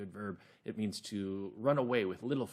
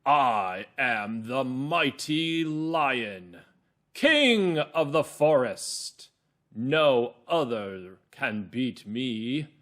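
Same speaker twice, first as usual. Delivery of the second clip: big, loud, very slow mighty lion; rough lion voice; 7 dB louder, much slower than usual. Shown as a demonstration. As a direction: boast loudly as king of the forest, stretching each word. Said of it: "So it might sound something like this".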